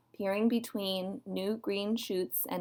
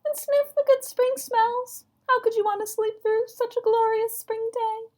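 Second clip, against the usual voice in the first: very high squeaky frog voice; high-pitched enthusiastic peeper; crying little peeper; 3 dB louder than usual. A narrator's reading. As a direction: gush as a tiny eager frog. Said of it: "cried the peeper".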